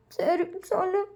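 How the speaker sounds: tearful Vayan. A narrator's reading. Sister caught fibbing by uncle, quietly tearful. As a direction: give in in a small tearful voice. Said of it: "அழுதுட்டே கண்ணெல்லாம் தொடச்சிட்டு".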